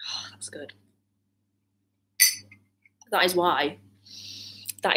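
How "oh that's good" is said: whispered relief. Real, not acted. Just took a sip of tea.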